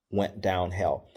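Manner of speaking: ordinary delivery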